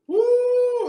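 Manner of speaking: high shouted holler of joy; exultant preacher whoop; ecstatic woo. Real, not acted. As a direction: let out a joyful church holler.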